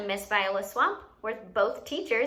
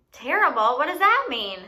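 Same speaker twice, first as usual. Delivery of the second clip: gasp, then a louder, higher, hammed-up "terrible?"; mock shock; 5 semitones higher, 5 dB louder than usual. Teacher pretends to be shocked at the word for her students, hammed-up gasp. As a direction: play up the shock for the kids.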